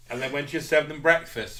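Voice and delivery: usual speaking voice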